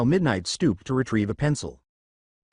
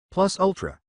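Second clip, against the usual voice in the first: higher, lightly British "Plus ultra"; British accent; 6 semitones higher than usual. A narrator's reading. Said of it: "sounded British enough to make a proper cup".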